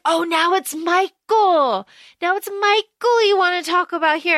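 hammed-up jealous outrage without real shouting; mock yelling. [name] yells at Celine about Michael, mock indignation. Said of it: "yells at her".

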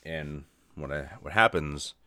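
ordinary talk, nothing marked

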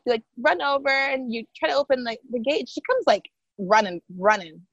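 usual speaking voice

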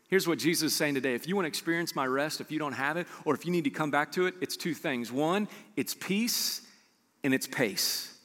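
normal talking voice

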